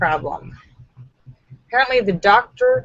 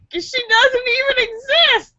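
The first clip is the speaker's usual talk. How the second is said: mock crying; 14 semitones higher than usual. Punchline said crying.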